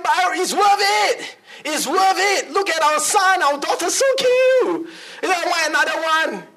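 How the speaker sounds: high, excited wife pleading her case; gushing wife. Acted. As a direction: coo over the children in a soaring voice. Wife gushing about wanting another child.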